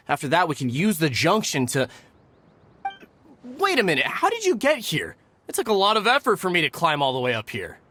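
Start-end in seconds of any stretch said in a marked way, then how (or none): 3.4-5.2 startled. Acted. Jeremy startled to see brother.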